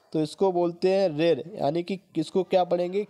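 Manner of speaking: ordinary talk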